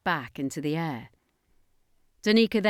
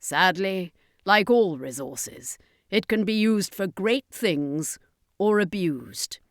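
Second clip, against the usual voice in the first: grave circus master; slower than usual. Acted.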